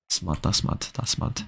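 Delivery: soft muttered disbelief; muttered aside. Real, not acted. Streamer reacting to a viewer's schedule, muttering softly. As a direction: say it under your breath.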